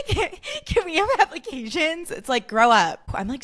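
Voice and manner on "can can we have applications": giggly high schooler. Retold or imitated. High schooler with friend asking for work, giggly. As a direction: imitate a giddy teen job-seeker, way up high. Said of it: "you're in high school and you're applying".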